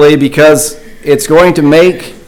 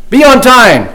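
higher, raised call; 9 semitones higher than usual. A narrator's reading. Another boy calls a rule to the teacher, raised and higher. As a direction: sing out the rule from the back. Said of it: "Another one yelled".

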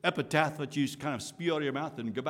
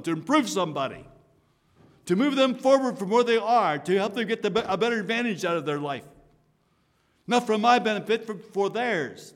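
rising, louder preacher's push; impassioned preaching; 6 semitones higher, 6 dB louder than usual. Real, not acted. Preacher urging the congregation, fervent and raised. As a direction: drive it home from the pulpit.

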